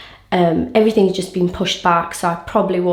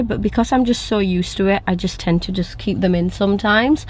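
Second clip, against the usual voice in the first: ordinary talk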